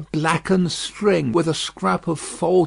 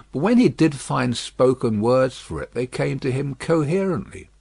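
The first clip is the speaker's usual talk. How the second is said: ordinary delivery, nothing marked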